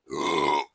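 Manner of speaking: quick throat clear. Real, not acted. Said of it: "excuse me".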